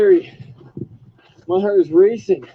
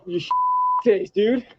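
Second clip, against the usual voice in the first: normal talking voice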